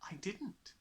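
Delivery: soft, smiling reply. Acted. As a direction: answer softly with a smile.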